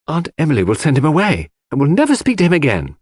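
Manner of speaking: cheerfully predicting good news. A narrator's reading. Cynthia hoping her aunt sends him away, cheerful. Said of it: "cheerfully hoped".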